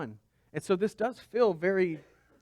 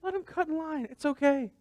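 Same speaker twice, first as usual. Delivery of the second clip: mock sad repeat; 12 semitones higher than usual. Real, not acted. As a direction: Let them cut in line said much higher, half joking. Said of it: "That's really sad".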